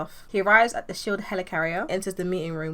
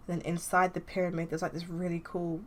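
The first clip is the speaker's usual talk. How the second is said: ordinary delivery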